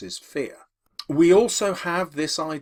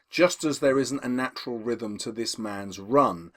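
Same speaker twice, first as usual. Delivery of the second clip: ordinary delivery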